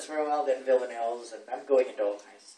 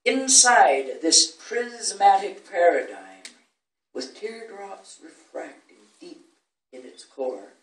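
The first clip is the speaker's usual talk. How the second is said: measured villanelle with raised, punched phrases; poetry recitation; 4 semitones higher, 8 dB louder, slower than usual. Acted. Recites own villanelle to audience.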